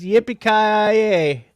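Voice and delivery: loud, high, drawn-out cowboy cheer; big playful holler; triumphant whoop. Pretend. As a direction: belt out the catchphrase and stretch the end. Whooping cowboy catchphrase to announce midnight.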